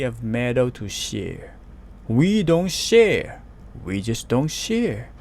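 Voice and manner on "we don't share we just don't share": growling. A narrator's reading. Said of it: "Tough growled".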